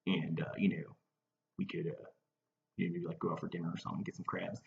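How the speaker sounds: tentative, trailing-off murmur; shy invitation. Real, not acted. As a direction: float a date softly, almost under your breath. Shyly floats a dinner date.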